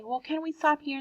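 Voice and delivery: a hint of a child asking to stop; child asking parents to stop the car. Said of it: "and you say".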